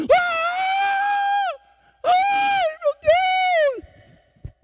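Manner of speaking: very high, loud cry of alarm; acted terrified scream. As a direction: scream it out, long and drawn. Acting out woman's frightened cry. (grito agudo de pavor encenado)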